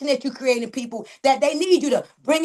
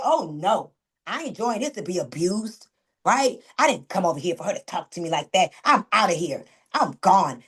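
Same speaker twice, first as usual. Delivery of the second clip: lower, huffy voice of a quitting member; offended recruit; 6 semitones lower than usual. Retold or imitated. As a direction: imitate a hurt member walking out, lower. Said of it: "They gonna say".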